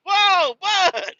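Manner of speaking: very high, loud acted alarm; shocked reaction. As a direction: re-enact a dad's startled double take. Father shocked at son's yelling.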